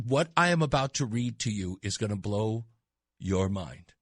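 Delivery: normal talking voice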